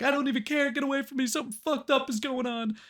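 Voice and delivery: high, loud outburst as a fleeing crook; frantic mock yell. Crook yelling at pursuing police to get away, frantic. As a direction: yell it in a high, strained panic.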